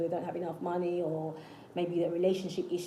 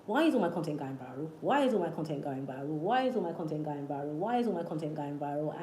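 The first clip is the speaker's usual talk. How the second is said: repetitive, low chant-like affirmation on one repeated tune; robotic affirming; 3 semitones lower than usual. Retold or imitated. Speaker recalling affirmations for a channel, robotic low repetition. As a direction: loop it mechanically, same melody every time. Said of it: "I was using Ask Formations".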